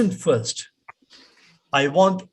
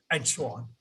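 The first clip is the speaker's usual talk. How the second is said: normal talking voice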